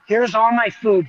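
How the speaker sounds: ordinary talk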